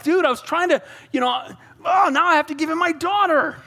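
high-pitched, put-upon complaint; exasperated. Acted. Saul grumbling about giving David his daughter, exasperated. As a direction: play up the exasperation of a cornered king. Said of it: "starting to get Saul a headache".

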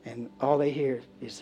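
normal talking voice

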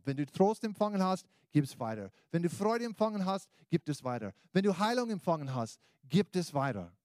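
loud, high preacher's call; rousing sermon voice; preaching. Acted. Preaching appeal.

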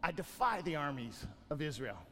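low, soft, menacing giant's boast; hint of a giant sneering at Israel; Goliath's taunt. Preacher voicing Goliath to the congregation, low and soft.

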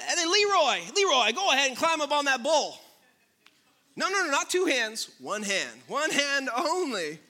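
loud rodeo announcer call. Acted. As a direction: shout it across the arena. Rodeo announcer calling to rider.

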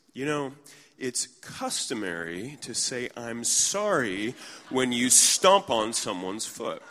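deliberate, slow scolding with loud stresses; pointed reproach. Retold or imitated. Woman to stranger who stepped on her foot, slow pointed reproach. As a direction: scold pointedly, drawing out the words.

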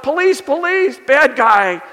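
high, loud mock cry of Police; hammed-up panicked call for the police; imagined yell for help. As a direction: pretend alarm, shouting at a bad guy. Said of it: "she yells out".

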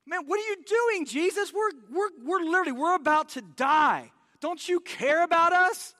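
high, loud panicked plea; panicked disciples. Retold or imitated. Disciples yelling at sleeping Jesus, high and frantic. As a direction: cry out as if the boat is sinking. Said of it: "They wake him up".

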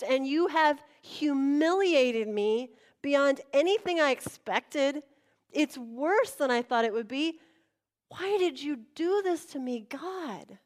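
acted wounded outburst; anguished protest. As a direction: cry out your humiliation to God. Acted anguished complaint to God.

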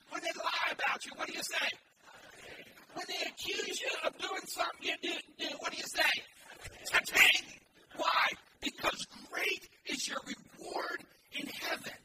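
loud, rising preacher's call and response; preaching at full force. Acted. Preacher firing questions at the congregation, loud. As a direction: drive it home like a revival preacher.

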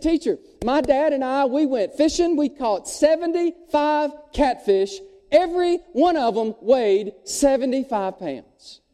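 lightly voiced kid spinning a tall tale; boastful little boy. Little Johnny tells his fish tale.

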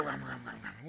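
very low mock mutter; soft, indistinct grumble; grumbling. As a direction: imitate discontented murmuring under the breath. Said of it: "That's what grumbling is".

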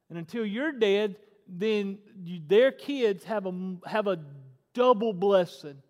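ordinary talk

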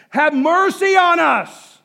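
high, loud, drawn-out desperate shout; screaming plea. Acted. Preacher acting blind beggars screaming to get Jesus' attention, anguished shout. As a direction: scream it out for mercy. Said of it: "they're screaming".

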